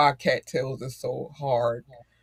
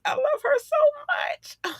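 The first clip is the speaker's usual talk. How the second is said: very high, cracking with tears; tearful gush. Real, not acted. Mom gushing about her daughter, voice cracking with tears. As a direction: let your voice break with love.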